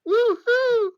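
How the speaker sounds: very high, loud gleeful whoop; cheer. Acted. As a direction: whoop like a thrilled kid. Tails cheering in fanfic.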